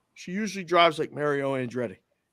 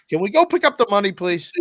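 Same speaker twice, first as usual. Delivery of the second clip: high, loud, rushed plea; exasperated begging, pitched way up; impatient pleading; 8 semitones higher, 8 dB louder than usual. Retold or imitated. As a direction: beg her to get moving. Pleading with napping wife.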